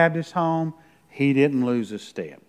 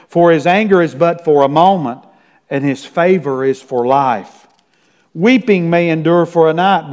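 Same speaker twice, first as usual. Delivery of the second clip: louder, ringing psalm reading; scripture reading; 5 dB louder than usual. Acted. Pastor reads a psalm to his congregation, ringing out.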